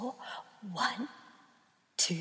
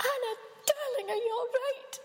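high, anxious tender voice; worried mother bird; 12 semitones higher, faster than usual. Acted. Mother bird to Anna, high anxious comfort. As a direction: soothe your frightened chick.

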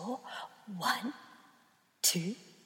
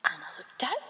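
ordinary delivery, nothing marked

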